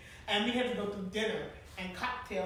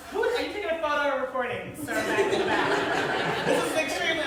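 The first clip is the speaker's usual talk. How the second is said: laughing loudly while telling off a man who is recording; laughing half-scold at the camera; laughing protest; 6 semitones higher, 9 dB louder than usual. Real, not acted. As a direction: laugh through a mock complaint.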